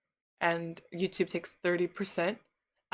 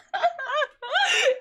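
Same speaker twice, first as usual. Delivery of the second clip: squeaky laugh mid-voice; high giggle; 4 dB louder than usual. Real, not acted. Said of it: "tickling the inside of my throat".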